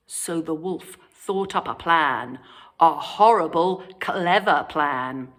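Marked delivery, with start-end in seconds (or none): none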